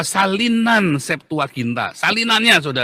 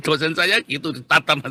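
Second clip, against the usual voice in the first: ordinary talk, nothing marked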